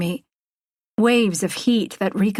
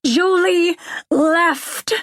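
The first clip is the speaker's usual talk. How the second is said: high, slow, drawn-out yell; top-of-lungs yell; 10 semitones higher, 3 dB louder, much slower than usual. A narrator's reading. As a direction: yell each word out long. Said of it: "yell at the top of my lungs".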